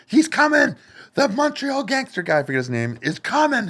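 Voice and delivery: high, urgent warning; frantic warning. Retold or imitated. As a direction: warn them in a panic. Warning gangster is coming.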